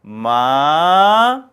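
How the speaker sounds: 마 climbing from re up to sol; second tone. Shown as a demonstration. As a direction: slide 마 steadily upward. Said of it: "레부터 솔까지 쭉 올라가죠".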